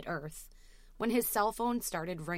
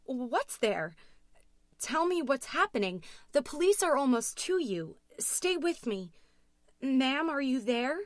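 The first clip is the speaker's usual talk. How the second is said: rising alarm; 7 semitones higher, 6 dB louder than usual. A narrator's reading. Dispatcher trying to stay calm with Louise, voice rising. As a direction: pitch climbing higher, louder and urgent. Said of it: "pitch of her voice was getting higher and higher".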